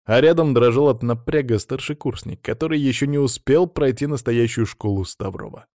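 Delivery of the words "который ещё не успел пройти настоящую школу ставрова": ordinary delivery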